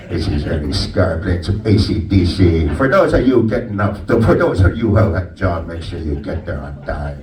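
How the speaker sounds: low radio DJ voice in a mock Blackfoot accent; Ernie Scar impression. Comic doing Ernie Scar from Brocket 99 for the crowd, low DJ voice. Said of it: "the star of the show is Ernie Scar".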